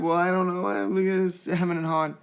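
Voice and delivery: a hint of a child's dithering; imitating a child. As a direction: play the unsure four-year-old. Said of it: "And she said".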